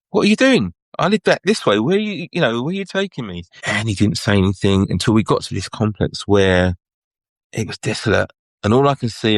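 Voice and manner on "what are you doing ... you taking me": hammed-up worry as the ride goes wrong; alarmed demand. Tourist demanding answers from a silent taxi driver, alarmed.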